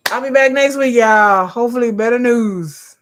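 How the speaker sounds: high, loud and bright promise to return; cheerful loud sign-off. Real, not acted. Vlogger telling viewers she'll be back next week, loud and cheerful. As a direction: call it out happily to the room. Signing off, computer dying.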